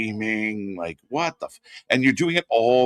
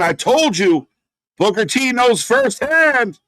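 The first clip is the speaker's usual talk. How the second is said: much higher, louder, slow shouted wrestling commentary; hyped play-by-play; 9 semitones higher, 5 dB louder, slower than usual. Acted. Calling Zilla Fatu's debut for viewers, loud hyped commentary. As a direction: call the debut like an arena announcer going wild.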